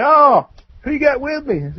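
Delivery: high-pitched, curious uncle greeting; trailer-park uncle. Retold or imitated. Uncle asking his nephew who came along, high-pitched greeting. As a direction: pitch it up as the uncle checking out company. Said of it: "He's like".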